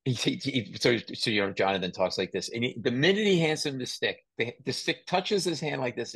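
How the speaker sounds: ordinary talk, nothing marked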